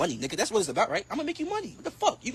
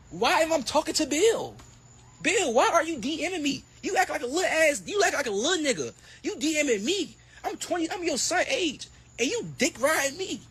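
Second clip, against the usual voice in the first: higher, heated call-out at Bill; worked-up rant; 9 semitones higher than usual. Real, not acted. Boxer calling out promoter Bill, heated and high. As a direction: fire it straight at Bill, pitched up.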